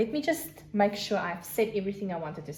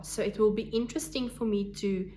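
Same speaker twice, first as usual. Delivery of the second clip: ordinary delivery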